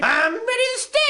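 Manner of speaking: high-pitched cartoon bear voice; pink bear. Pink bear reporting ready for battle, high-pitched. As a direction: announce it high, lifting at the end. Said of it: "So, Pink's ready".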